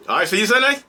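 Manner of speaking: high, falsely cheerful farewell; mock polite. Narrator faking a cheery goodbye to the abuser. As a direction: put the mask back on with a chirpy goodbye. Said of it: "I was like".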